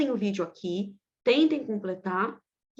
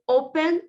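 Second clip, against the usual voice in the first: "open" with a strong, higher first syllable; stress on first syllable; 6 semitones higher, 6 dB louder than usual. Shown as a demonstration. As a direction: lean on the O that starts "open". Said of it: "se a sílaba forte, né, for a primeira".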